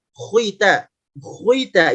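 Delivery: "khita" rounded into "khuita"; overly thick kha. Shown as a demonstration. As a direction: say "khita" too heavy, with a w glide. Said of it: "itu pun berlebihan". ("khita" terlalu tebal seperti "khuita")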